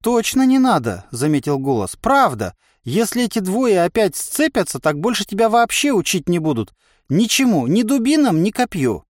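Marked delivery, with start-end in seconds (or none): 0.0-1.0 insistent inner voice. A narrator's reading. Inner voice argues back. 2.0-9.0 higher-pitched voice in the head; cautioning inner voice. A narrator's reading.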